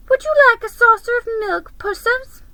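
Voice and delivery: very high, fast mocking coo; sing-song taunt, rushed and cruel; jeering. Creatures taunting Aslan, very high fast coo. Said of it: "jeering at him".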